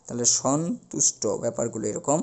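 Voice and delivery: ordinary talk, nothing marked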